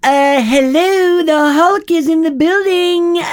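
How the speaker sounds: very high, slow, loud announcement; hammed-up high-pitched proclamation; put-on silly voice. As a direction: stretch it out in a high mock voice. Breaks into a put-on announcer impression.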